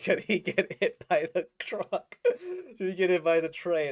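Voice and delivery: panicked giggling question; laughing mock worry. Reacting to the truck fake-out, laughing loudly. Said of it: "I was like".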